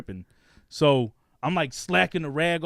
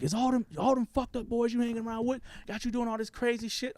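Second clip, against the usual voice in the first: high-pitched imitation of mother scolding; scolding mom; 8 semitones higher, 4 dB quieter than usual. Mom blaming the boys he hangs with, high scolding imitation. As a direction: mimic a mom blaming his friends. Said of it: "she's like".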